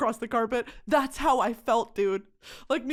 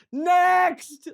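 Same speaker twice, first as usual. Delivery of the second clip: mock barked next; 7 semitones higher, 6 dB louder than usual. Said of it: "it's like".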